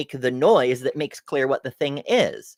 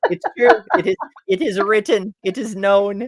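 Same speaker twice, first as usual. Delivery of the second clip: mock solemn; 5 semitones higher, 4 dB louder than usual. Mock solemn scripture pronouncement.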